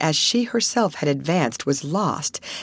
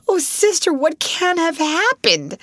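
sniffling; 12 semitones higher, 4 dB louder than usual. A narrator's reading. Miss Amelia to her sister, tearful. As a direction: whimper through tears. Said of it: "began to wring her fat hands and cry".

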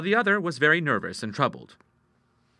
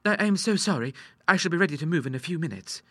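put-on higher girlish voice; polite, unbothered daughter; calm young lady; 7 semitones higher than usual. A narrator's reading. Ida apologising to the maid, calmly.

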